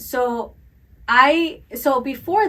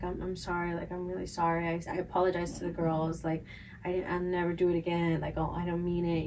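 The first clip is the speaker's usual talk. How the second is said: meek apology; 5 semitones lower, 12 dB quieter, faster than usual. Retold or imitated. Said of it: "I was just like".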